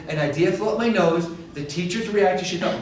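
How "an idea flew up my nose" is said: put-on little girl voice. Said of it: "She said".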